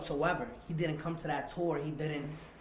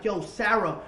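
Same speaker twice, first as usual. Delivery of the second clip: loud, raised-pitch angry address; retold rage, calling her out forcefully; raging; 5 semitones higher, 9 dB louder, slower than usual. Man accusing his girlfriend mid-fight, raging. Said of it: "I'm, I'm, I'm raging".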